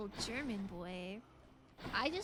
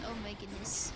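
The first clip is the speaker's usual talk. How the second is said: normal talking voice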